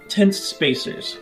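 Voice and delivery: ordinary talk, nothing marked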